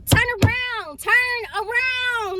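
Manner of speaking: high, drawn-out calling; retold yelling. Passenger yelling at taxi driver, high drawn-out mock yell. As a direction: imitate yelling it, stretching the words. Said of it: "So I'm yelling at the man".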